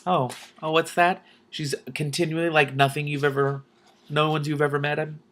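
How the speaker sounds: usual speaking voice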